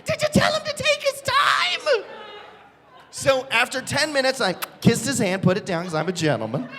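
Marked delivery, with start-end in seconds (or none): 0.1-2.0 very high wifely shriek; mock wife. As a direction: screech the question as the excited wife. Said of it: "And she's like".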